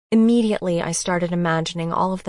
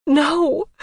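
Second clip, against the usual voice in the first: anguished no; 9 semitones higher, 6 dB louder than usual. Acted. Hearing the father may die.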